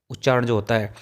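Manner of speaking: ordinary talk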